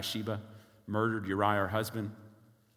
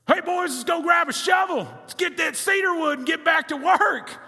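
loud, high Texas country holler; Hebrew country accent; 9 dB louder than usual. Acted. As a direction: shout it like a country foreman rallying the boys, hammed-up Texas accent. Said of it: "That's like Hebrew country accent probably is how they sounded".

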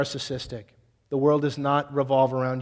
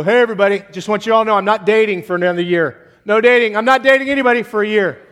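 higher, louder public declaration; mock spiritual announcement; 5 semitones higher, 8 dB louder, faster than usual. Guy to everybody, loudly announcing no dating. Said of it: "They'll get real spiritual and go".

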